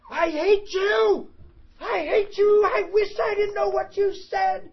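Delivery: high, loud furious scream; desperate yelling at God; screaming at the Bible. Retold or imitated. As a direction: scream it at the top of your lungs. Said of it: "I screamed at my Bible".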